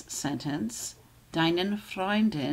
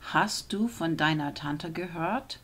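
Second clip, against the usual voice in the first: ordinary delivery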